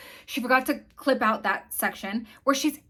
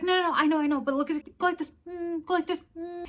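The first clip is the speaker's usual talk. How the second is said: higher-pitched imitation of a dismissive, directing mom; imitated stage-mom, pitched up; coaching mom; 8 semitones higher, faster than usual. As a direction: mimic a mother brushing off and coaching. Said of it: "And she's like".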